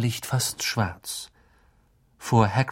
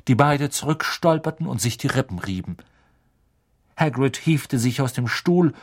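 usual speaking voice

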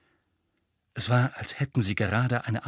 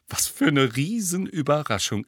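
dry sarcastic aside; 5 semitones higher than usual. A narrator's reading. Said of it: "entgegnete Hermine halblaut".

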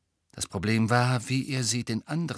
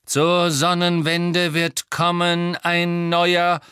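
slow, raised, loud incantation; prophesying old man's spirit; 7 semitones higher, 7 dB louder, much slower than usual. A narrator's reading. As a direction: intone a prophecy like a ghostly seer. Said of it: "sagte die Gestalt eines alten".

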